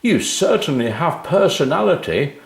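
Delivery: high, hearty jovial voice of a friendly man; a jolly businessman amused; cheery big man. A narrator's reading. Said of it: "he smiled".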